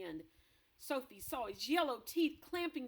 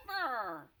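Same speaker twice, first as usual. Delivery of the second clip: loud, earnest protest; passionate cry; 8 dB louder than usual. Acted. BFG protesting to Blood Bottler, loud and passionate. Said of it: "cried the BFG passionately".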